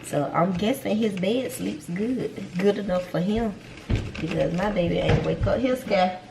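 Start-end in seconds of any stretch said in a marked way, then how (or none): none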